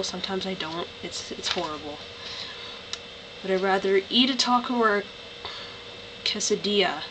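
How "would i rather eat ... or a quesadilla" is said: ordinary delivery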